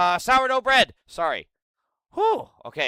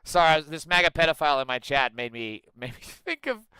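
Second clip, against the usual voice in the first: ordinary delivery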